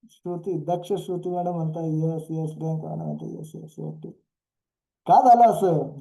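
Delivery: normal talking voice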